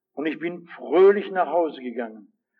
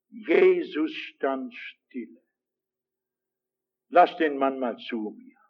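slow solemn; 5 semitones lower, slower than usual. Shown as a demonstration. Repeated verse slowly.

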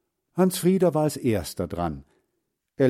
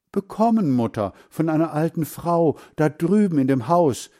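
put-on high, louder boyish explanation; eager explaining; 6 semitones higher, 4 dB louder than usual. A narrator's reading. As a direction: explain it to mother with raised pitch. Boy eagerly explains to his mother. (eifrig, mit hoher Stimme erklärend)

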